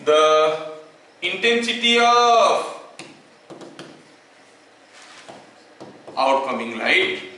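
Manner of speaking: word by word, very slow dictation with long pauses; teacher dictating a law for notes. Acted. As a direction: dictate to students taking notes.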